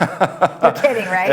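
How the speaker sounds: teasing disbelief, laughing as you say it. Real, not acted. Host teases about East Coast weather.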